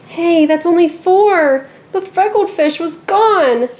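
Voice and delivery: put-on high, slow, alarmed girl; the girl cries out that a fish is gone; upset surprise. A narrator's reading. Girl discovers another missing fish.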